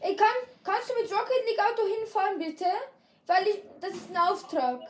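high, rushed pleading; fast eager pleading. Real, not acted. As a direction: blurt it out fast. Pleading to teammate quickly.

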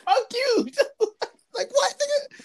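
squeaky, laughing mock retort; high laughing quote. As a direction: toss it back high through laughter. Quoting the movie, laughing.

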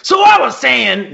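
high, loud, drawn-out comedian impression; George Carlin. Podcaster attempting a requested celebrity impression, loud comic. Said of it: "George Carlin".

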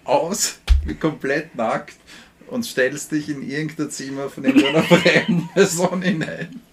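loud, high, laughing through words; giggling uncontrollably while talking. Real, not acted. Absurd story, laughing fit.

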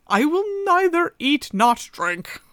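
put-on the man refusing the old woman's food; firm refusal. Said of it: "answered the man".

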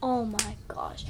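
an awestruck whisper, almost no voice; awed whisper. Real, not acted. Girl telling her dad how good the pizza was, hushed whisper.

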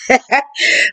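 short high chuckle; laugh. Real, not acted. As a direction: break into amused laughter. Amused by own acted lines.

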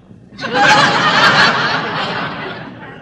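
long loud audience laugh; audience laughter. Real, not acted. Studio audience laughing at the Dutch joke. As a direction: crowd roaring with laughter.

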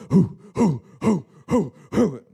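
loud rhythmic "hoo" puffs; imitated whooshes of warriors charging down slopes; vocal sound effect. Shown as a demonstration. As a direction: punch out short, falling, low hoos. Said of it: "Sound effects".

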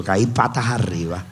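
ordinary delivery

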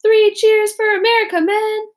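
shouted cheer to the troops; officer's shout. Acted. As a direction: rouse the men as the boat pushes off. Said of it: "officers shouted".

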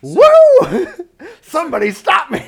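loud, high whoop into a mock catchphrase; hammed-up manic outburst with a laugh; wild hype. Clowning burst of hype energy.

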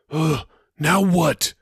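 slow, weary groan of annoyance; drawn-out exasperated sigh; annoyed sigh. Acted. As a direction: sigh it out, fed up. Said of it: "he sighed".

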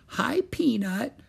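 put-on high, slow baby-talk greeting; sweet high little-girl voice; cooing. A narrator's reading. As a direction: coo hello to a tiny pet. Annie cooing to the mouse.

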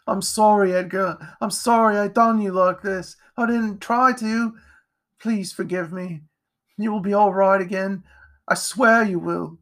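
high, earnest pleading; anguished apology. A narrator's reading. Jack apologises to wounded Edgar before fleeing, anguished. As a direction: beg forgiveness, pitched high throughout. Boy begs forgiveness of dying friend.

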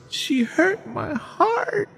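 high, slow, fake-sobbing little boy; mock crying boy. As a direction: whimper it out like a heartbroken toddler. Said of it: "And he goes".

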